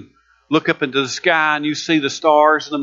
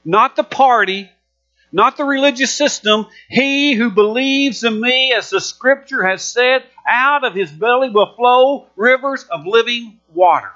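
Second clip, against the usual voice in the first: slow, higher proclaiming cry; preaching; 4 semitones higher than usual. Acted. Jesus cries out to the crowd, proclaiming slowly. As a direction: lift the verse like a call to the crowd.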